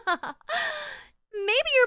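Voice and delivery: high, slow, laughing threat; laughing mock threat.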